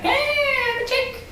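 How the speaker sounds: very high and slow mock lady voice; weird lady voice. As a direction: drag it out like the odd cruise lady. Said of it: "She was just like".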